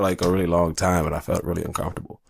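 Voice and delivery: ordinary talk, nothing marked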